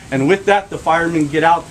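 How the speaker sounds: ordinary delivery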